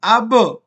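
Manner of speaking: अब with the ब bouncing into a short vowel; echoed qalqalah. Shown as a demonstration. Teacher showing the proper reading, echoed qalqalah on अब. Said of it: "हम इसको पढ़ेंगे".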